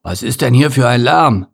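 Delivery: lightly voiced grumpy bear; a touch of bear-like grumble; grumbling bear. A narrator's reading. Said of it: "brummte plötzlich eine tiefe Stimme".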